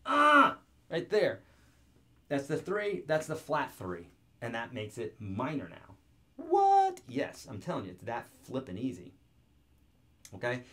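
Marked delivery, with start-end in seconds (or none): 0.1-0.6 loud, high "Ah!" of pretend effort; mock strained yell. Mock struggle at easy guitar move. 6.4-7.0 very high, loud incredulous squeal; mock amazement. Feigned amazement at how easy it is.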